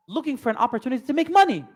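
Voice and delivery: ordinary delivery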